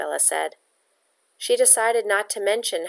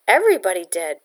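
a touch defensive, a little louder; 4 dB louder, faster than usual. Acted. Girl defending herself to Pietro, mildly defensive. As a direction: defend yourself firmly.

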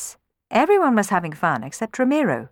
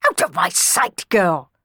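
barking. A narrator's reading. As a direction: bark at the girl to go. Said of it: "barked Don Magnifico".

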